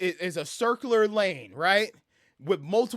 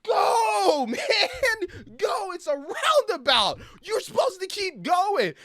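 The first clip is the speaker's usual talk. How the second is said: high, loud frustrated yelling at a driver; exasperated shouting with laughter breaking in; road rage yell; 13 semitones higher, 5 dB louder, slower than usual. Retold or imitated. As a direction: scream at the stalled car to move. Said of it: "I'm like".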